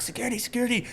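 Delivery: high, hushed mock alarm call; imitated security call. Retelling the club staff calling guards, mock alarm. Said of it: "they're like".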